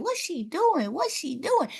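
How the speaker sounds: high-pitched nosy onlooker; high mock-curious questioning; nosy passerby. Mimics nosy passersby watching her.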